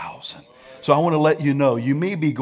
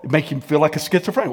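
usual speaking voice

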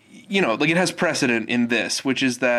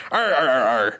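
pirate growl. Retold or imitated. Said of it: "they're like".

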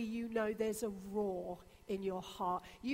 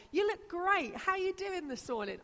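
higher and louder, bursting with cheer; gushing American greeting; 7 semitones higher, 4 dB louder than usual. Retold or imitated. Pastor mimicking an enthusiastic American hello, higher and louder. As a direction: greet them like an over-the-top friendly American. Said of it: "freedom in some cultures to just say".

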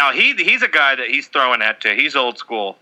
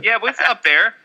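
put-on Canadian accent; 6 semitones higher than usual. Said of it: "he's a Canadian".

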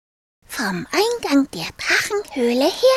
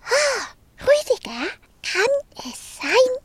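put-on higher curious little girl asking; a small girl wondering aloud, pitched up; 6 semitones higher than usual. Marie asks Rüdiger why he looks different, high girl voice.

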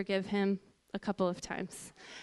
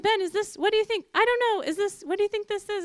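much higher, louder, fast flustered questions; excited panic; 9 semitones higher, 6 dB louder, faster than usual. Retold or imitated. Wife asking husband about the pregnancy test, much higher and louder. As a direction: blurt it out, stunned by the test.

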